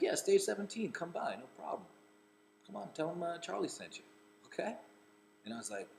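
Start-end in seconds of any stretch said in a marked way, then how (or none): 0.0-4.7 high, light offhand instructions; casual celebrity. Retold or imitated. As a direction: breeze through the directions. Imitating Charlie giving directions.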